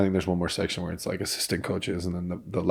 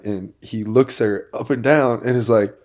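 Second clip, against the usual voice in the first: usual speaking voice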